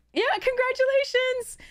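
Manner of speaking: congratulations sung out very high; excited congratulations. Real, not acted. Streamer congratulates the giveaway winner, squealing very high. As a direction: squeal it with delight.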